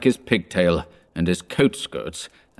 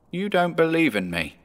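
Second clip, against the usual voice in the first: high, softer spectral tone; a quiet ghost's reproach; 8 semitones higher, 3 dB quieter than usual. A narrator's reading. Ghost speaks.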